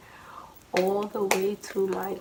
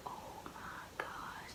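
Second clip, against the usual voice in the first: barely audible, slow whisper; hushed dread; 20 dB quieter, much slower than usual. Retold or imitated. Child realizing mom is coming, hushed dread. Dread at being caught, acted.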